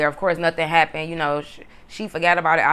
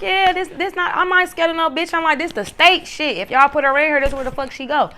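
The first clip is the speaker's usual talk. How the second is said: much higher, defiant, dismissive; defiant retort; 10 semitones higher, 3 dB louder than usual. Retold or imitated. Telling the guards she's not scared, high defiant retort. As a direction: brush off the guards like it's nothing. Defiant reply to police at door.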